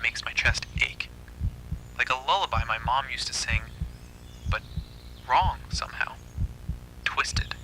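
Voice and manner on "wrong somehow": ordinary delivery, nothing marked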